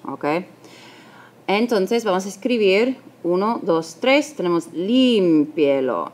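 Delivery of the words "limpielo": "limpielo" with LIM stretched and stressed; stress on first syllable. Shown as a demonstration. Teacher showing where the accent falls, "limpielo" stressed on LIM. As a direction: lean long on the LIM of "limpielo". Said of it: "Aquí tenemos el acento".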